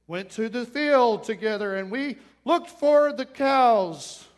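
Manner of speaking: stiff, slow recital with loud, over-stretched words; hammed-up robotic line reading, drawn out word by word; robotic bad actor. Bad actor reciting his lines on stage, robotic. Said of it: "And it's robotic. It's like".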